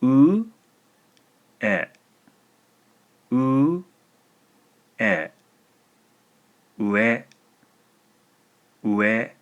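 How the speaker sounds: ue said slowly and clearly; clear listening prompt. Shown as a demonstration. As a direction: say ue for a dictation. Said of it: "The word is".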